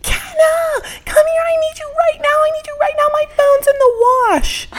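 screaming from downstairs for her roommate; frantic screaming. Retold or imitated. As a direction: yell for help like the world is ending. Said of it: "screaming from downstairs".

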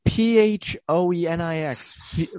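P H O E N I X slowly letter by letter; slow letter-by-letter spelling. Retold or imitated. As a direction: read each letter like a puzzled foreigner. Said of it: "they're like".

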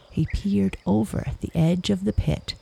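ordinary delivery, nothing marked

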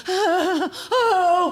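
mock weepy wail; passive-aggressive cry. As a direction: fake a sob for attention. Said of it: "passive-aggressive, like, cry".

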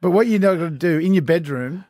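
ordinary talk

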